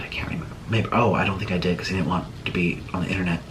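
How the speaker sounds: hushed, fast whisper to herself; whispered aside. Real, not acted. Unsure whether she tagged him, whispered aside. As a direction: drop to a quick private whisper.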